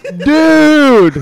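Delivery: high, loud, drawn-out protest; exasperated cry. Retold or imitated. Friend reading his text to the panicking comic, exasperated. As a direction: stretch it into one exasperated shout.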